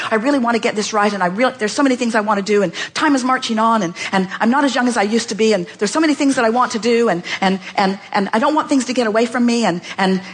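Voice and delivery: fast, pressed worried rambling; hurried fretful listing; rushed anxious demo. Shown as a demonstration. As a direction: rattle it off like time is running out. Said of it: "we'll sort of demonstrate".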